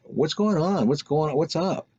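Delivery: mock high, anxious girl's voice; upset girls. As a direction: pitch it up, worried and pleading. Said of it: "the girls in back were very upset".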